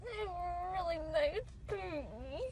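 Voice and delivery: slow, weepy and dazed; groggy from anesthesia. Real, not acted. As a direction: drift through it, half asleep. Groggy weepy after surgery.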